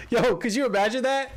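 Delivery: cracking up with pure hype; giddy excitement. Real, not acted. Laughing at his own dream.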